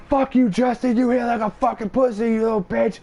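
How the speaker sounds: high, loud furious yelling at Justin; snapped. Retold or imitated. Friend retelling how he snapped at Justin, furious high yell. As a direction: lose it and scream the insults. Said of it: "I snapped a little bit".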